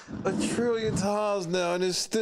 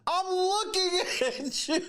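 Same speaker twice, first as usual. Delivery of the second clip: incredulous; 8 semitones higher than usual. Real, not acted. Incredulous reaction to the clip.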